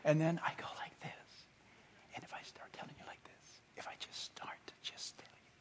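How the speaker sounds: barely audible secretive whisper; hushed, confiding demonstration whisper. Shown as a demonstration. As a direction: drop right down to a voiceless whisper. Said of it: "He's whispering".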